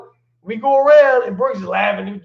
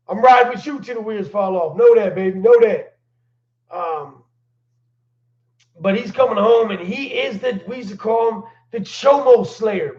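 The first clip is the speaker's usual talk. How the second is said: usual speaking voice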